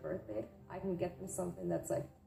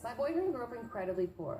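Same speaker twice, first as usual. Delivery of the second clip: ordinary talk, nothing marked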